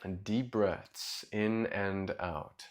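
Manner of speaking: soft, low meditation voice with long pauses; guided meditation. Real, not acted. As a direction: guide them gently into their breath, quietly and low.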